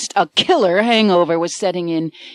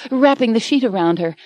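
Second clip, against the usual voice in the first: ordinary delivery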